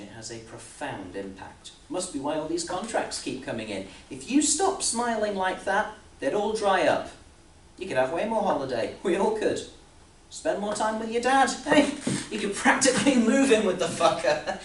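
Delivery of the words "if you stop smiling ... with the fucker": high, loud tirade building to a peak; heated rant. Acted. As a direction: raise your voice higher and louder as you rant. Sarcastic rant at employee, building.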